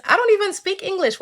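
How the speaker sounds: high-pitched shrimp protesting innocence; mock-indignant little shrimp; defensive shrimp. Shrimp denies it to the lady.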